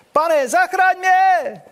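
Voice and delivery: high, slow panicked shout for help; desperate cry. Acted. Sinking Peter cries out to his Lord, panicked shout. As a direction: yell it like you're going under. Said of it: "začal tonout a vykřikl".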